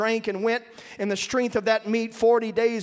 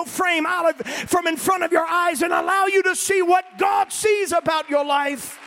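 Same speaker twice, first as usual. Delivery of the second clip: full-force preaching; 5 semitones higher than usual. Acted. Preacher urging the congregation to look up, shouting. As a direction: lift the room, preach with all your lungs.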